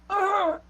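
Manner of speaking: high yelp as if punched; acted cry from a blow; pained yelp. Old man is punched by his questioners, yelping. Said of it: "lets out this yelp as if he's been punched".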